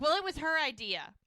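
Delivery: high, loud mock protest; hastily pin it on someone else; defensive blame-shifting. Ladies blame Joellen for the rice bags, defensive.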